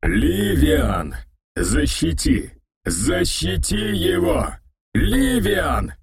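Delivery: distorted monster howl, pitched high; eldritch horror. A narrator's reading. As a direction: wail it like a wounded monster. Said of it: "завыл громкий искажённый голос".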